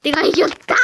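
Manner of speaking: ordinary delivery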